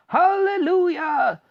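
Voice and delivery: loud, high, slow hallelujah; joyful yell. Acted. Grandpa Joe rejoicing at the news, loud high slow yell. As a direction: cry it out to the heavens. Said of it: "yelled Grandpa Joe".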